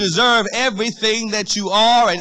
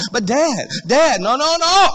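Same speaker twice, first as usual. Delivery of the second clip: put-on rising child protest; 6 semitones higher than usual.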